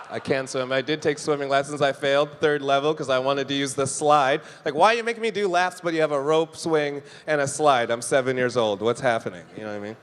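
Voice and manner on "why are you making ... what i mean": ordinary talk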